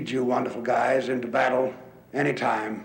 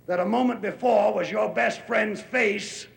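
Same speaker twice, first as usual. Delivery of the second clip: ordinary talk, nothing marked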